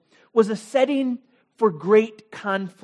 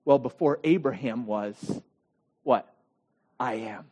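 calm Jesus; 9 semitones lower than usual. Acted. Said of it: "And Jesus says".

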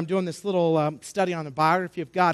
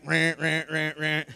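repeated lower-pitched imitated siren; mock alarm buzzer; 3 semitones lower, slower than usual. Preacher flags trouble in the boy's story, mock alarm. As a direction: sound the warning like an alarm.

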